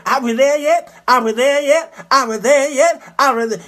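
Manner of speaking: insistent kiddie chant, punched each time; whining kids. Retold or imitated. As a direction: pester like children on a car trip. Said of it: "the kids in the back saying".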